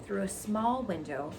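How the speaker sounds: ordinary delivery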